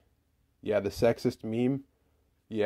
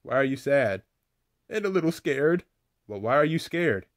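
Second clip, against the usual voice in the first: normal talking voice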